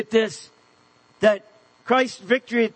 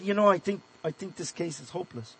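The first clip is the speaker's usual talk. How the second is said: low, much softer, defeated; hopeless murmur; 5 semitones lower, 8 dB quieter, faster than usual. Retold or imitated. Telling his mentor the case is hopeless, quietly. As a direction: let it sink into a quiet despair. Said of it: "I said".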